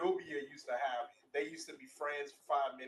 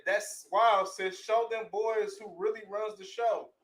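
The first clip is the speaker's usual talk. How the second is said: higher, louder hype voice; cheering sister; 7 semitones higher, 9 dB louder, slower than usual. Acted. Sister hyping the storm girl, loud cheer. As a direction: egg her on.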